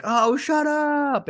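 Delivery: high, slow, thrilled squeal; excited. Retold or imitated. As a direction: squeal it out in delight. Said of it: "I was like".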